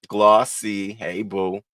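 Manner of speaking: ordinary talk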